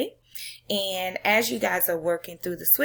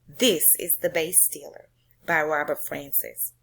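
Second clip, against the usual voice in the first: ordinary talk, nothing marked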